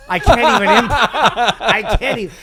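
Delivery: words breaking into laughter; can't get it out for laughing; high, loud, cracking up. Real, not acted. Cracking up mid-sentence.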